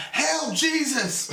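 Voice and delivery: high, slow mock cheer; joking mock yell. Answering the crowd's call as a joke, mock yell. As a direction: parody the fan cry, drawn out.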